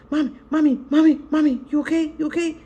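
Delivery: put-on high, anxious child calling mommy; a scared kid checking on mom; worried little boy. Young son waking at night, worried about his mother.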